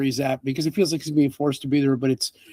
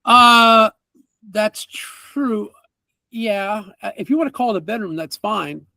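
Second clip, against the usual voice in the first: flustered merchant; 4 semitones higher than usual. Acted.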